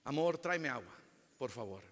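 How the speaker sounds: soft, tender ask to a spouse; gentle request. Shown as a demonstration. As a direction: ask sweetly, softly. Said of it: "es la manera que lo decimos". (pedido suave y cariñoso)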